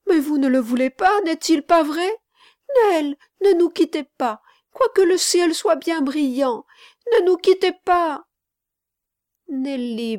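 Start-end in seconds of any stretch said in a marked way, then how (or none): 0.1-8.2 put-on high, loud, pleading child; distressed little boy imploring a friend to stay. A narrator's reading. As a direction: beg urgently in a small boy's voice. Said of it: "reprit le petit garçon".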